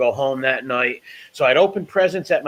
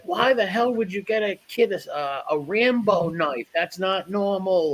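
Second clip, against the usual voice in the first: mock angry aunt, higher and slower; 7 semitones higher than usual. Aunt scolding father over the Rambo knife, mock outrage. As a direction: play the aunt scolding her brother. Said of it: "being like".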